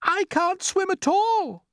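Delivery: very high, loud, slow cry; frightened cry. A narrator's reading. Ladybird who cannot swim, crying out. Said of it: "cried the ladybird".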